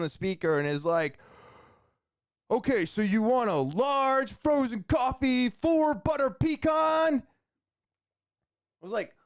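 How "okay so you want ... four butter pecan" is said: high-pitched, over-excited drive-thru yell, stretching the last words; imitated energetic yelling. Drive-thru worker repeating the order over the speaker, yelling like crazy. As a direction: belt the order back like a hyped-up announcer. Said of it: "He's yelling like crazy".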